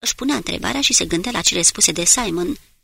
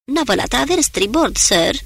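higher, louder lookout report; announcing; 5 semitones higher, 5 dB louder than usual. Acted. Lookout reports boat to starboard, loud call. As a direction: call the sighting up to the captain. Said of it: "și anunță".

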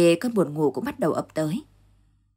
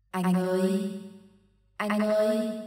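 ghostly call; 3 semitones higher, 4 dB quieter, slower than usual. Acted. Ghost calls softly in dark.

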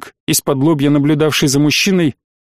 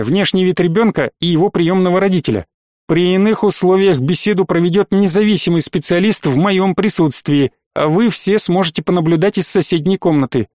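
usual speaking voice

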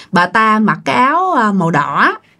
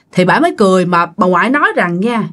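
ordinary delivery, nothing marked

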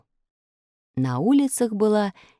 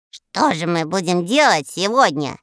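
sighing. Acted. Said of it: "вздохнул Мурзилка".

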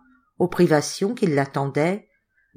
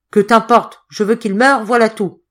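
loud, high, brusque retort; angry insistence; 4 semitones higher, 7 dB louder than usual. A narrator's reading. Hotelier refusing to explain his hatred, angry insistence. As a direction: shut the question down forcefully.

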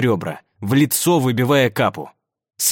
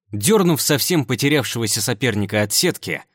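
ordinary delivery, nothing marked

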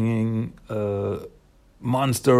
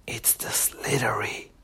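put-on hushed, slow whisper of a frightened kid; spooky whisper; 7 dB quieter, slower than usual. A girl whispers the monster's name to her friends, hushed and slow. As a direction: breathe the name like a secret.

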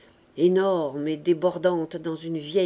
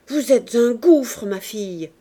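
contemptuous insult; 2 semitones higher, 7 dB louder than usual. A narrator's reading. Mistress insults the maid.

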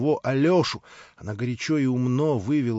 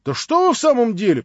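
higher, louder, whining complaint; plaintive protest; 7 semitones higher, 5 dB louder than usual. A narrator's reading. Alyosha protesting plaintively to Katya. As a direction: plead like a wronged boy. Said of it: "жалобным голосом".

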